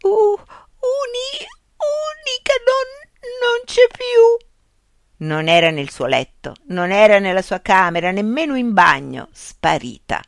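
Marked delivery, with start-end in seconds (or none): 0.0-5.2 very high, slow, broken stammer; aghast, words catching and drawn out; dismayed stammering. A narrator's reading. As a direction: stammer it out in a high, wailing panic. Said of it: "balbettava perché".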